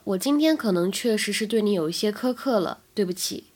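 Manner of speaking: normal talking voice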